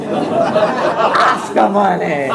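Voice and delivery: very slow, stretched-out delivery; slow, relished comic reveal; drawn-out punchline. Retold or imitated. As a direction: savour it, stretching each vowel. Punchline answer in retold phone call.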